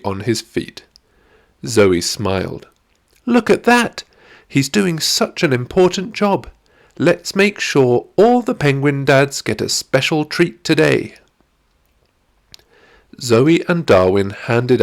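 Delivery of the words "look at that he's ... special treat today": put-on high, thrilled girl's voice; delighted young girl. Zoe watching the penguin dad with Darwin, high delighted girl voice. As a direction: point it out with sheer delight.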